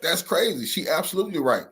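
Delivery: usual speaking voice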